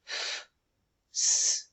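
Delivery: F and s as bare voiceless sounds; F... s, separated, no letter names; sounds in isolation. Shown as a demonstration. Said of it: "look at the sounds in that word".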